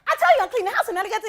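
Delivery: high, loud indignant rush; the wife protests she did everything, loud and high; exasperated outburst. Retold or imitated. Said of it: "she was like".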